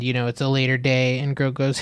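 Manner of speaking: ordinary delivery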